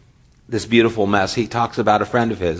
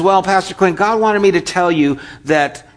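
put-on higher, eager teen voice; eager car wash boy; 7 semitones higher, 4 dB louder than usual. Car wash boy asking Pastor Clint for a word, eager and higher. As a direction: pipe up like a keen kid at the door.

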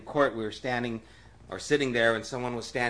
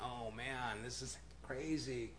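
soft aside of a stunned onlooker; lightly acted, under-the-breath reaction; hushed dismay; 11 dB quieter than usual. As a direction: imitate muttering to yourself in disbelief. Retold onlooker's aside in court.

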